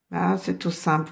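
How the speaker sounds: usual speaking voice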